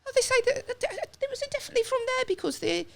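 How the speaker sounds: high mock Brummie woman; mimicked Brummie woman. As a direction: squeak it out like the patronising woman. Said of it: "she's like".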